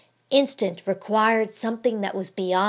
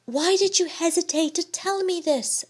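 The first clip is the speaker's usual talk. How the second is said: higher, searching question; anxious wife; 8 semitones higher than usual. A narrator's reading. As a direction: ask with worried urgency.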